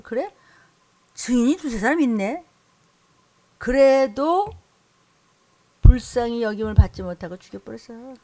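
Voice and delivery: ordinary delivery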